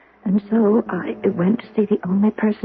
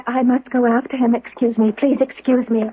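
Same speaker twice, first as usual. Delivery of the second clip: normal talking voice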